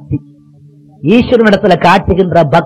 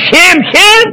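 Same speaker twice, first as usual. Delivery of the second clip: high, sharp cry of shame; mock scolding father; 11 semitones higher than usual. Father shaming his son for stealing, high scolding cry.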